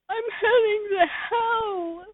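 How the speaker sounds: very high, wailing whine of the listener; high crying voice. Acted. The listener confessing to Pimpich in a high crying voice. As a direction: squeak it out like a crybaby.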